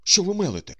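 loud, high-pitched retort; indignant outburst. A narrator's reading. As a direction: burst out in protest. Startled companion objects to offer.

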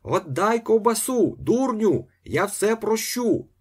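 high, loud pleading holler; wailing shout. A narrator's reading. Ostap pleading with the priest above, high wail. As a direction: wail it up high as a desperate bargain. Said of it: "заголосив Остап".

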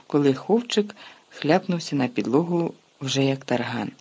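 plain reading voice

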